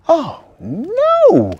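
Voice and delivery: put-on very high, loud child cry; a little boy's shocked cry; dismayed cry. Timmy hears about the lost presents, high dismayed cry. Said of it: "cried Timmy".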